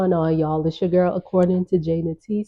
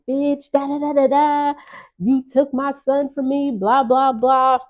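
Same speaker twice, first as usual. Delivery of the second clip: higher, hammed-up rant trailing into blah blah; lightly imitated angry mother, pitched up; mock ranting mom; 7 semitones higher than usual. Rob's mom ranting in the elevator, mock angry rant. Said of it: "still talking like".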